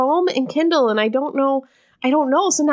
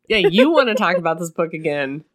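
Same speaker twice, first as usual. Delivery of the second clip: dry sarcastic; 7 semitones lower than usual. Real, not acted.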